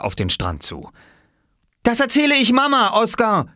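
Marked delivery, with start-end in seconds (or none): 1.8-3.5 very high, loud shout; threatening cry. Acted. Girl swimming ashore threatening to tell mother, high shout.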